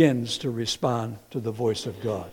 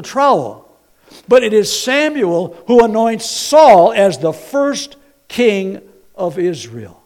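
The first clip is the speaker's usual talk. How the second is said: full-force preaching; 8 semitones higher, 10 dB louder than usual. Acted. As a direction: hammer home who the prophet is.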